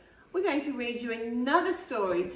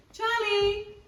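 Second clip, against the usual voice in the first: higher call to the dog; 14 semitones higher than usual. Real, not acted. The reader calls her wandering dog, higher. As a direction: call the dog from across the room.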